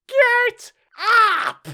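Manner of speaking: piercing high royal command; shrill, loud shout. A narrator's reading. Queen ordering the gardeners to rise, shrill loud voice. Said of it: "in a shrill, loud voice".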